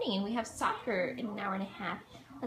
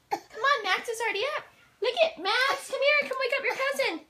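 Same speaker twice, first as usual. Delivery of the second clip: high coaxing call; 8 semitones higher than usual. Real, not acted.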